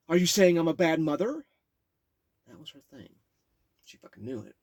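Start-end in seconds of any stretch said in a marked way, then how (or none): 0.1-1.5 loud, high indignant mom; defensive mother. Retold or imitated. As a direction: snap back as an offended mom. Drunk mom retorting.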